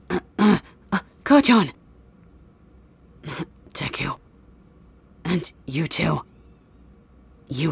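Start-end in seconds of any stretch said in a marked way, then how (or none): 3.7-4.2 faint whisper. Acted. Dying boy speaks weakly.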